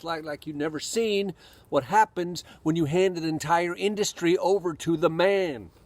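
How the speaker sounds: ordinary talk, nothing marked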